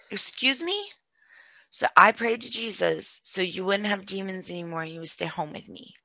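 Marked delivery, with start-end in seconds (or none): 0.1-0.9 shocked disbelief. Retold or imitated. Reacting to sister's odd question.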